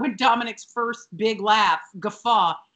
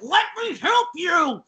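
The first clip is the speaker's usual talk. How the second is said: high, drawn-out mock plea; mock pushy insistence; 7 semitones higher, 3 dB louder, slower than usual. Tootie manhandling Missus Garrett's bedding, hammed-up pushy insistence. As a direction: insist on helping, hammed-up and slow. Acting Tootie forcing help.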